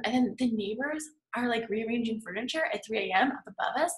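usual speaking voice

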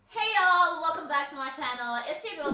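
loud high hey; excited greeting. Real, not acted. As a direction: burst in with a big hello. Energetic channel greeting.

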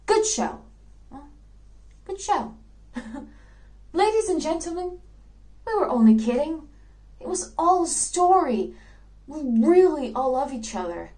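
high, congratulatory voice of a dead actor; cheery risen dead. Acted. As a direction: play a slain player heartily praising the killer. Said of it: "dead people should suddenly rise off the floor".

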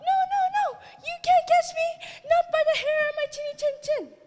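very high, loud piggy squeal. Acted. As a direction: squeal in a panic like a piglet.

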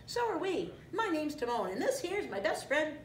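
high cartoon sidekick voice; chatty meerkat. A narrator's reading. Meerkat introduces himself.

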